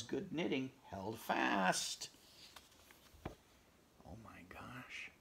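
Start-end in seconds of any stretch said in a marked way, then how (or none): 4.1-5.1 slow awed whisper. Real, not acted. Amazed at the bursting mitten, slow whisper.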